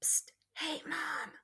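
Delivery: breathy whisper calling mom. Shown as a demonstration. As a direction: sneak a whispered call to mom. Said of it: "I whispered".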